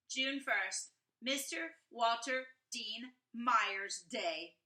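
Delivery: put-on lively read-aloud as a boy writing letters; reading to children. A narrator's reading. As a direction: read it out brightly for young listeners, like the boy himself. Reads children's book aloud.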